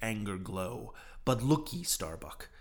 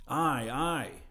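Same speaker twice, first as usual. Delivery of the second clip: crew shouting; 4 semitones higher than usual. A narrator's reading. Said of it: "shouted the harpooners and seamen".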